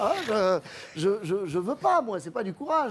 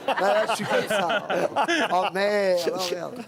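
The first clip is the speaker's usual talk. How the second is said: real laughter breaking into the words; losing it, laughing while talking; laughing fit. Real, not acted. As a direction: crack up and curse helplessly through the laughs. Cracks up mid-sentence.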